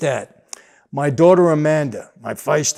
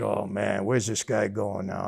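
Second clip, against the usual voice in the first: low, quieter muttering to himself; muttered aside; 7 semitones lower, 8 dB quieter than usual. Retold or imitated. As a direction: mutter it under your breath.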